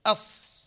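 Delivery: "أص" high, heavy hissed ص, lips relaxed; heavy letter. Shown as a demonstration. Teacher shows the inherently heavy letter, hissed "أص". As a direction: let "أص" be heavy by itself, no pout. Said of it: "الحرف مفخم بذاته".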